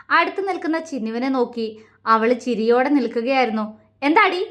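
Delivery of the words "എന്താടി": sharp, higher and louder snap; angry raised voice. A narrator's reading. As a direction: bark the question in anger. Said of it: "ശബ്ദം ദേഷ്യത്തോടെ ഉയർന്നുപോയി".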